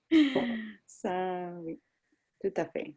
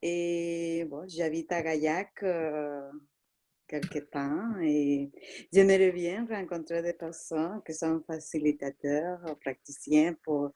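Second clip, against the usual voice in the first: real Latin American accent in French; French with a Spanish-speaking accent; foreign accent in french. Real, not acted. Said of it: "ton accent est merveilleux".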